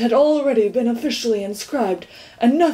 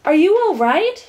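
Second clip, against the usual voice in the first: higher, louder worried call; shouted; 8 semitones higher, 5 dB louder than usual. Acted. Milo checking on the fallen watchdog, shouting. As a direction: call out in alarm. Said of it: "shouted Milo".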